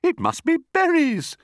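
very high, excited bird voice; singsong bird. A narrator's reading. Roly-poly bird guesses the food, high singsong. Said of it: "sang the roly-poly bird".

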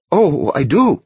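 high breathless protest; gasped out. Acted. Novice gasping his protest to the abbot. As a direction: blurt it on a gasp. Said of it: "the novice gasped".

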